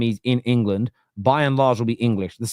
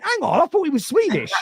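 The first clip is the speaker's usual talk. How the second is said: surprised. Retold or imitated. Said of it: "and I went".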